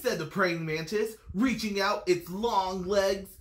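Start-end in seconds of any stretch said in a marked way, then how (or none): none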